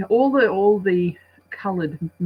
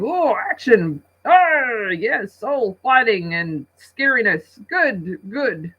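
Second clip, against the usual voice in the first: louder, hammed-up excited men; mock macho men; 6 semitones higher, 5 dB louder, slower than usual. Men at the movie cheering the fight scenes, hammed-up. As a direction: grunt approval like action-loving blokes. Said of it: "the men are going".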